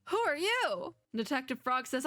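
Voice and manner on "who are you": high-pitched mock startled yelp; hammed-up startled demand, high. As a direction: jump as if someone crept up on you. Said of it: "I didn't mean to startle you".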